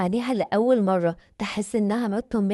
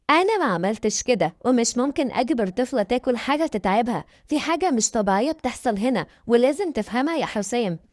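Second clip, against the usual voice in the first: plain reading voice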